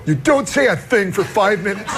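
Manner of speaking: high, laughing delivery; laughing through words. Real, not acted. Host retelling Super Dave's advice, laughing through it. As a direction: talk through the giggles.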